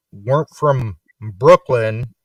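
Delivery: normal talking voice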